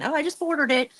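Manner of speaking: high-pitched quoted viewer; imitating a buyer. Crafter quotes a viewer who just ordered, high imitation. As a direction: pitch it up, imitating someone announcing a purchase. Quoting a viewer's impulse purchase.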